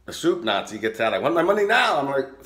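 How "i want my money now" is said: high, loud mock demand; demanding cabbie. Taxi driver demanding his money, high loud mock demand. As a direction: bark it like a greedy driver wanting cash now.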